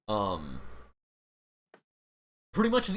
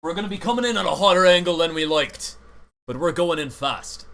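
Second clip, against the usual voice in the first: urgent captain order; 4 semitones higher, 7 dB louder than usual. Acted. Captain warns of steep approach.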